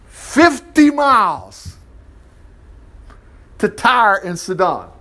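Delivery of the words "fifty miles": FIFTY MILES stretched out slow and high; high, drawn-out emphasis on "fifty miles"; emphatic. Real, not acted. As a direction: hammer "fifty miles", drawing out each word. Stressing how far he walked.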